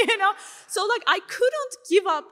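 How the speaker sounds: laughed-out tag question, high and loud; laughing. Real, not acted. Speaker jokes to the audience about an awkward conversation, laughing. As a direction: break into a real laugh on the words.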